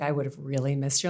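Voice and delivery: usual speaking voice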